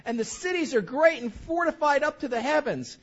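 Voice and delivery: high, loud whining complaint; whiny voice. Pretend. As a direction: whine it like sulky Israelites. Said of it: "I'll do a little whiny voice".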